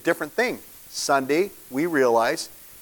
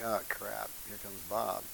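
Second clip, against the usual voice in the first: much softer, muttering dread; quiet groaning mutter; 5 semitones lower, 15 dB quieter than usual. Retold or imitated. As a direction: grumble it under your breath as the inspector arrives. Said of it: "they're like".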